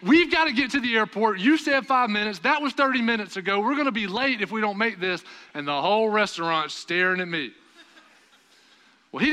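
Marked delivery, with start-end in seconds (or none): 0.0-5.2 loud, high, urgent telling-off; retold yelling. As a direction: yell at him to hurry for the airport. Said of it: "So I start yelling at him".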